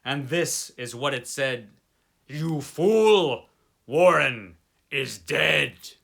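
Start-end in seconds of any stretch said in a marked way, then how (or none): 2.3-5.9 hollow inhuman voice. Acted. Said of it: "the voice was deep, hollow ... inhuman".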